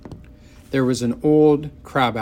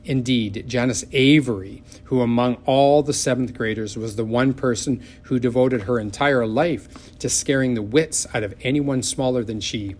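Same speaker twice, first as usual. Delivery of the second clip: ordinary delivery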